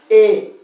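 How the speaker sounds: the majhul mistake, a loud "ay" in اے; majhul reading. Shown as a demonstration. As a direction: say اے as one "e" vowel, no glide to "i". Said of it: "مجہول کیا ہوگا؟".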